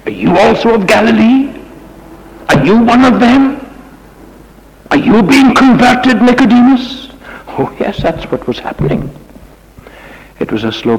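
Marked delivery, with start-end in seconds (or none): 0.0-7.1 high, loud, lashing questions; vicious accusation. Acted. They round on Nicodemus as a suspected convert, viciously accusing. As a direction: round on him like a whiplash, pitched far up. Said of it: "they rounded on him viciously like a whiplash".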